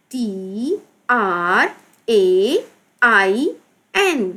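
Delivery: slow, drawn-out letter names; spelling out. Shown as a demonstration. Teacher spelling out the word. (धीरे-धीरे, खींचकर हिज्जे)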